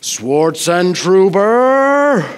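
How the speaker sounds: loud, very slow rumbling summons of the name; rumbling teacher call-out. Retold or imitated. High school calculus teacher calling the pastor out in class, loud rumbling drawn-out name. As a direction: boom the name across the classroom for everyone to hear. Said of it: "would just in this rumbling voice".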